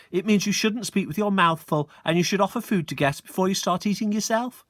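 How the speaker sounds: put-on prissy girl correcting manners; triplet girl lecturing. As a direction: explain it like a smug prefect. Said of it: "she said".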